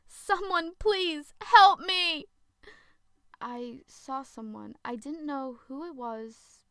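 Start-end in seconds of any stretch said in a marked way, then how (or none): 0.1-2.3 high, loud, slow desperate scream; scream for help. Acted. Zoe fleeing the creature, calling for help, screaming. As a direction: stretch out a frantic cry for help. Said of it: "I screamed on the top of my lungs".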